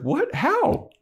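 very high disbelieving yelp; shocked squeak. Retold or imitated. Xander hearing the boss did it, high shocked squeak.

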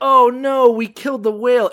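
loud, high mock dismay; mock sailors' alarm. Retelling the sailors killing the whale, loud mock dismay. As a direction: ham up the sailors' horror. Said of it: "they're just like".

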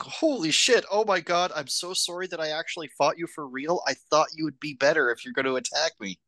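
hammed-up panic, rushing the sorry; mock flustered Ichi. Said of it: "he's like".

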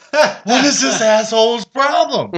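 high, slow, half-laughing outrage; mock outrage at a rude actor; laughing disbelief. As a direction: laugh through the indignation. Retold inner reaction, laughing.

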